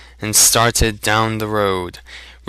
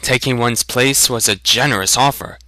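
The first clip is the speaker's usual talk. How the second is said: usual speaking voice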